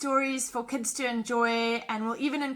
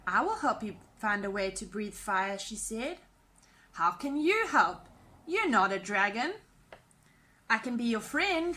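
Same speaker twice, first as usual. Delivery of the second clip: put-on lively storybook narration for kids; animated picture-book reading voice; reading to children. A narrator's reading. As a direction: read it like bedtime story time. Reads a children's story aloud.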